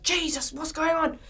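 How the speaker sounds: lightly acted alarm; mock panic. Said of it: "Like, panicking".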